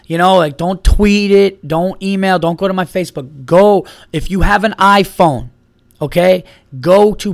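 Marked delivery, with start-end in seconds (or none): 0.6-3.4 emphatic plea. Real, not acted. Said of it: "urging listeners".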